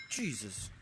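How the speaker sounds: usual speaking voice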